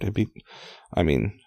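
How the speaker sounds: plain reading voice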